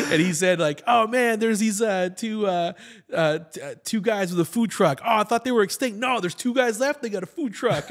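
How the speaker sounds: high, loud excited comic voice; hammed-up retelling of a comedy bit; quoting a friend's bit. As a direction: pitch it up like telling a punchline. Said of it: "a great comedy bit where he's like".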